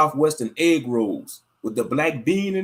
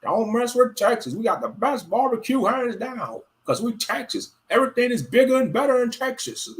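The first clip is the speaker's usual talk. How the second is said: higher, lightly put-on bragging Texan; mock Texan; 8 semitones higher than usual. Imitating Texans boasting about their state, raised mock-proud voice. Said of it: "them Gentiles told them Texas".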